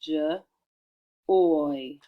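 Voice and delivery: "J, oy" as juh, pause, long oy; short isolated "J", then a full "oy"; tapping out sounds. Shown as a demonstration. Teacher tapping out joy, "J, oy" sounded separately. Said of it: "Let's tap out joy".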